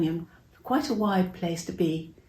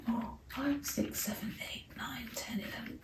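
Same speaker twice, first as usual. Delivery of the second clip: counting softer and softer until it is whispered; fading to a whisper; 4 semitones higher, 15 dB quieter than usual. Pretend. Counting disciples into the boat for children, fading to a whisper. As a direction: let the count die away into a whisper.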